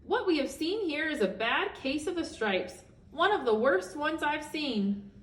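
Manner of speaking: high, gentle granny tut; sweet old woman. Acted. Old woman looks over Camilla's room, high granny voice. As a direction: cluck it like a kindly grandmother. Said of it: "with a shake of her head".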